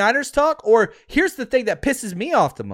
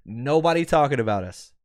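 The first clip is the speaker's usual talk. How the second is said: ordinary delivery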